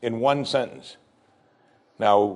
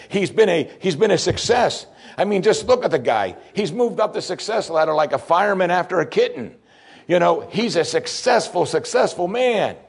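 higher, animated sermon build; rising preacher emphasis; 6 semitones higher than usual. Acted. Preacher describing Joseph's rise to the congregation, animated. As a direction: lift it up with conviction.

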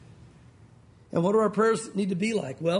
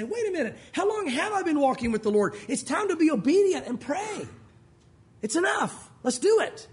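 higher-pitched, urgent wake-up call to yourself; rousing self-exhortation; 6 semitones higher than usual. Acted. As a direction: stir yourself up, pitched high. Preacher voices a rousing self-exhortation.

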